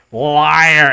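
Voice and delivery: ordinary talk, nothing marked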